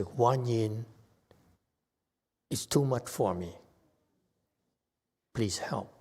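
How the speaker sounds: a soft, calm prayer instead of a scream; calm quiet call. Shown as a demonstration. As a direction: ask quietly and simply, no screaming. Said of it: "You call Guan Yin".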